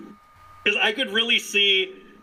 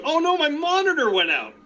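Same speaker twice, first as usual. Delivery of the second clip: higher mock-innocent commentator; imitating Kevin Kelly; 10 semitones higher than usual. Commentator pretending not to see the cheating, higher mock innocence. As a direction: play dumb, as if the screen just died. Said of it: "Kevin Kelly goes".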